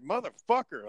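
mildly acted exasperated swear; retold pissed-off curse. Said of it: "I was, like, pissed off".

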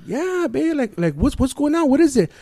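high-pitched mock woman; imitated anxious female phone voice; questioning wife impression. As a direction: nag the husband for answers in falsetto.

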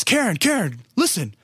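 high-pitched, urgent imitation of dad; imitated eager summons; excited calling out. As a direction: call for attention, thrilled to share.

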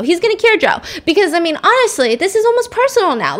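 high, punchy excited rant; half-joking crusader zeal; fired up. Real, not acted.

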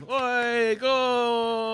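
high, drawn-out cheering shout; football cheer. Acted. Player's character cheers the football game, high drawn-out shout. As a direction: holler at the match, stretching the words. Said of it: "I start cheering about the football game".

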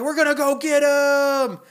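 high, loud rallying yell; mob shout. Retold or imitated. Torch-bearing mob setting off after monster, loud high yell. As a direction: whip up the mob to chase him. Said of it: "they're all like".

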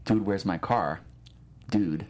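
ordinary delivery

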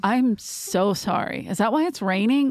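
slow, wide-eyed mock sorry; mock apology. Child answering her scolding grandma, slow and innocent. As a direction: drawl it out in innocent confusion. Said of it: "I was like".

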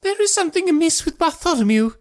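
very high, alarmed gentleman's voice; frightened cry. Acted. Thaddeus runs out of the house, high frightened cry. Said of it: "he cried".

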